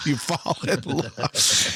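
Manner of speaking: slow, laughing agreement. Real, not acted. Agreeing with his co-host while laughing, slow.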